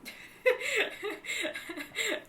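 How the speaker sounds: high-pitched burst of laughter; real high laugh. Real, not acted. Amused at co-host's remarks.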